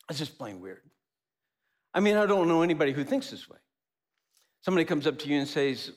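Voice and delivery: ordinary delivery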